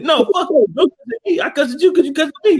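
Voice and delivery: laughing mock quarrel; mock argument. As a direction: fight back like a grumpy old man. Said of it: "he said".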